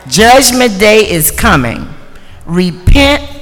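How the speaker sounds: loud proclaiming cry of doom; doomsday street preacher. Acted. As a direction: call the crowd to repent. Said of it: "proclaiming the topic loudly".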